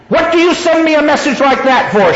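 high-pitched, fierce challenge. A narrator's reading. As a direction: demand an answer, pitched well up. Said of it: "fierce voice".

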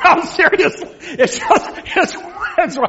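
high laughing burst. Real, not acted. Teacher laughing at his contractor joke, high and loud. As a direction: crack up at your own joke. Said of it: "teacher laughs at own joke".

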